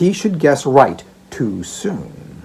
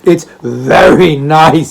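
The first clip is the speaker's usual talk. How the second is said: laughing delight; 3 semitones higher, 9 dB louder than usual. Acted. Old father sniffing the basket, laughing with relish. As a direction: chuckle and savour the smell. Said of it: "inhaling laughing gas".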